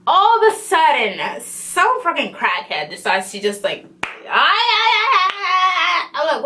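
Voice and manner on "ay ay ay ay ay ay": high, drawn-out mock wail; retold yelling. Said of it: "And she just yelling the whole time".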